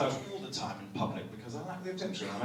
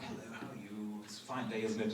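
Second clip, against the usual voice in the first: low, soft British greeting; politely murmured in a British accent; Sebastian voice; 5 semitones lower, 9 dB quieter than usual. Acted. Guest greets the hotel clerk in character, soft British voice. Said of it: "I was just doing this voice".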